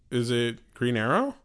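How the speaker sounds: ordinary delivery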